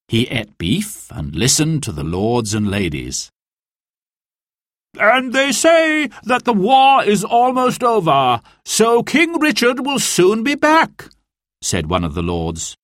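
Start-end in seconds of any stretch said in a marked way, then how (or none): 4.9-11.1 high, far-off lordly voice; a lord telling news at the sheriff's table; lord at dinner. Acted.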